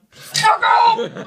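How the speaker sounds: sudden top-of-lungs yell, way up high; loud yelled curse, pitch shooting up; furious shout. Retold or imitated. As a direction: explode at him in a screaming swear. Retold outburst at son.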